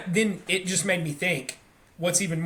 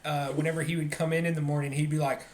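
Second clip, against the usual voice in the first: normal talking voice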